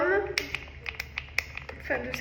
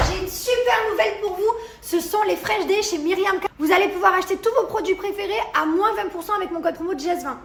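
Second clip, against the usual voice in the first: upbeat high sponsor pitch, louder; ad read; 5 semitones higher, 4 dB louder than usual. Acted. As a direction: sell the promo code like an influencer ad. Sponsored promo code read.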